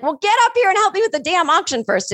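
bossy retort. Retold or imitated. Mary orders Adam to help with the auction, mock-bossy. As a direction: snap at him to help first, half-playful.

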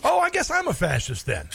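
high-pitched sarcastic retort; sarcastic mock realisation. Mocking retort to clip's accusation.

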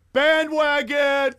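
drawn-out taunting yell of bandwagon; mock heckle. Fan mocking him for liking two teams, slow loud taunt. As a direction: sneer it out slowly like a fan calling him a bandwagoner. Fans mock his team choice.

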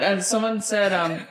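ordinary delivery

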